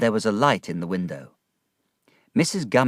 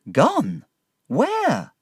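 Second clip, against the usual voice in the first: rising high, shocked question; shocked cry; 11 semitones higher, 3 dB louder than usual. A narrator's reading. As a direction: leap up in disbelief. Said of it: "I cried".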